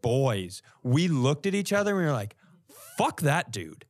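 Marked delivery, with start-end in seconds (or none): none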